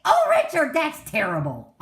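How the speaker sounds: high, loud scandalized gasp; mock shocked church lady. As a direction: shriek it like a horrified old lady. Old ladies shocked at joke.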